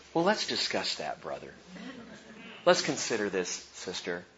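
soft, low, measured mock-pious reply; calm and prayerful. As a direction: answer gently, keeping your composure. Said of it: "stay calm and prayerful and say".

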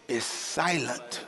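dropped soft and slow, a laugh under it; soft, slow punchline. Acted. Preacher softly delivering the silent prayer joke. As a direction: hush it for the joke.

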